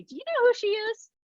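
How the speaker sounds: squeaky, snooty inner critic; gremlin voice. Retold or imitated. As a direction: sneer it from way up high like a catty critic. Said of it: "now my gremlin tells me".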